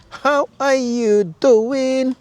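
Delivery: very slow, high-pitched greeting; drawn-out pet greeting. Real, not acted. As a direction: stretch every word out high to a pet. Exaggerated greeting to an animal.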